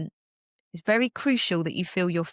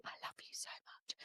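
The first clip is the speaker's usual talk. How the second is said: hushed secret whisper to members; whispering to Embody members, hushed and fond; whispered aside; 24 dB quieter than usual. Real, not acted.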